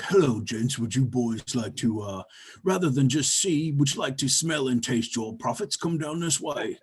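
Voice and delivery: lightly voiced slick street pimp; a hint of a smooth hustler beckoning; sleazy pimp luring two elves down an alley, lightly played; pimp Mickey Mac. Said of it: "it's, like, literally like that".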